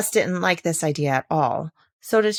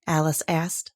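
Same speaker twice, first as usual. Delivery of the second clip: usual speaking voice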